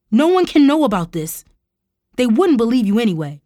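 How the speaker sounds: high, loud desperate protest; cried out. A narrator's reading. Uncle protests being exposed, desperate cry. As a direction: cry out, hurrying toward the end. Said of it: "he cried".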